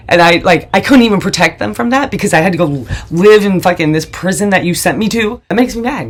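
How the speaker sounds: ordinary talk, nothing marked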